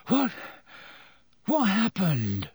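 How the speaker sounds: slow, groggy waking groan; just woken, head aching; boy waking groggily, groaning. A narrator's reading. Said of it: "he groaned".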